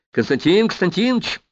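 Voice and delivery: repeated call, pitched high and rising; insistent calling out. Acted. Повторный оклик, его не слышат. (настойчивый высокий оклик)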